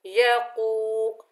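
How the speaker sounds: यकू drawn out very slowly with a thick कौ; slow, heavy यकू; heavy qaf. Shown as a demonstration. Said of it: "कौफ को मोटा पढ़ेंगे".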